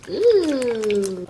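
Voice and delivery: usual speaking voice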